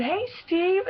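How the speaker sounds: high excited call of Hey, Steve!; calling out his name as she runs past; shouted greeting. Retold or imitated. Fan calling out to Steve while running to her seat, high excited call. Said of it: "I shouted".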